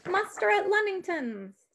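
high urgent rallying cry; cried out. A narrator's reading. Sybil rallying villagers with lanterns, crying out. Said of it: "Sybil cried".

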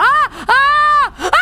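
high shrieks building in fright; repeated screams. Real, not acted. Streamer approaching the floating lady, repeated screams. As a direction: scream several times in panic.

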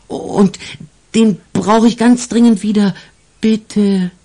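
put-on high, pleading little boy voice; stammering boy. The angel boy begs the police for his sack, high and pleading. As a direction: stumble and plead as the child. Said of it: "stotterte Ambrosius".

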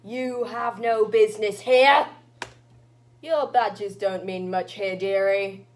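put-on stern old granny witch. Granny to the visitors seeking passage, haughty old witch. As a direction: challenge the visitors, then recognise one. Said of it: "wake an old witch".

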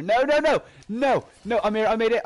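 loud, high frantic claim; urgent protest. Real, not acted. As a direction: shout it to win the race. Racing to claim the bow.

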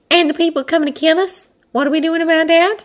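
high, pressing hare voice; impatient hare. A narrator's reading. Hare interrupts about the coming attackers, impatient. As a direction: press them in a high, hurried voice.

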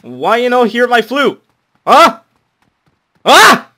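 very high, very loud broken-English yell; squealing angry demand in broken English; furious yelling. Acted. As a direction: shriek at them to listen.

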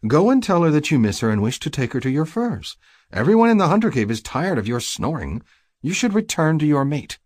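high-pitched sister nagging her brother; bossy sister. Acted.

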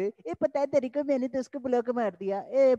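a hint of a silly college girl gossiping; mimicking gossipy girl. Teacher mimics girls on metro gossiping, mild girlish voice. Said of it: "ढाई ढाई रुपए वाली बातें कर रही है".

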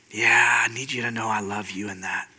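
very soft, low, near-whispered reassurance; tender Father. Acted. The Father reassuring a struggling son, tender and hushed. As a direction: breathe it gently as a loving father.

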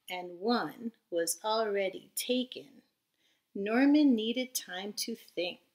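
lively storybook read-aloud voice; reading to children. A narrator's reading. Teacher reading a storybook aloud to her class, lively. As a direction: read it bright and animated for little listeners. Reads picture book to children.

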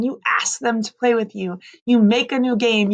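ordinary delivery

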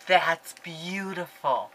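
ordinary talk

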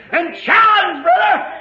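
high, full-force pulpit shout; preaching shout. Real, not acted. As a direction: cry out the challenge to the congregation. Preacher shouts the voice's call.

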